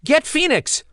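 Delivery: very high, loud panicked scream. Acted. Evol screaming to the griffins as Phoenix falls. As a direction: shriek the order in panic. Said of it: "she screamed telepathically at them".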